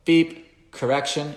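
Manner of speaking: high, loud called-out correction; calling the correction. Shown as a demonstration. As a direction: call it out as the dog runs off.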